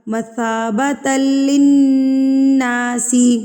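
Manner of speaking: very slow, drawn-out tajweed chant; Quran recitation. Shown as a demonstration. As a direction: stretch the verse melodically. Recites the verse. (ٹھہر ٹھہر کر تلاوت)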